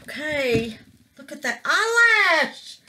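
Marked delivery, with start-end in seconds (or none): 1.2-2.8 emotional cry. Real, not acted. Emotional reveal.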